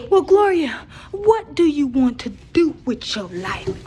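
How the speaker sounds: high, slow eerie voice; slow spooky questioning; creepy voice. Retold or imitated. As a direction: drag it out like a ghost. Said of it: "All creepy and shit".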